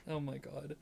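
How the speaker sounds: ordinary talk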